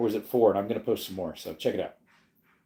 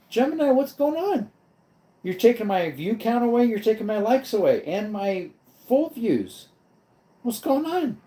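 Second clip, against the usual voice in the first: exasperated; 10 semitones higher than usual. Real, not acted. Scolding the Gemini counter.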